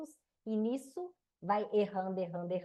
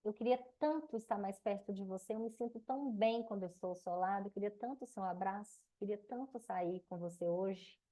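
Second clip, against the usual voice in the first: softer, loving voice voicing a desire; tender wish; 5 dB quieter than usual. Shown as a demonstration. Wife telling husband she longs to be near him, soft and tender. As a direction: melt into it, speak the longing gently. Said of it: "Falo assim".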